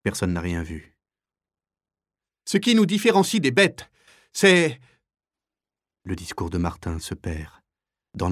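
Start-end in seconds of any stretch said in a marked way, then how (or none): 2.5-4.8 high, loud declamation; raised orator voice. Acted. Martin addressing prisoners over a noisy crowd, loud declaiming. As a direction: speak up loudly over the crowd.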